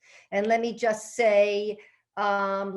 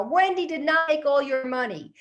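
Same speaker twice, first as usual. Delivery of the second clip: higher, louder, exasperated yell; screaming louder; 6 semitones higher, 4 dB louder than usual. Retold or imitated. Husband repeats himself to his mother, louder screaming. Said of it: "only on a louder octave".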